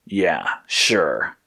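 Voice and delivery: low, hesitant agreement. A narrator's reading. Red agreeing to leave with Blue, hesitant. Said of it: "Red said hesitantly".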